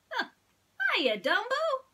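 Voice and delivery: high, bright little mouse voice; squeaky high mouse greeting his friend; Timothy the mouse. Acted. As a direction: play a tiny cheerful mouse.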